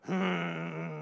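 dog grumble. Retold or imitated. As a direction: imitate a grumpy dog. Dog's growl imitated.

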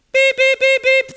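high, loud repeated beeps; imitated machine alarm. Donation machine going off mid-session, imitated beeping. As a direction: mimic a shrieking monitor alarm.

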